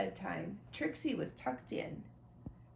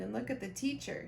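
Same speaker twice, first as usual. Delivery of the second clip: ordinary delivery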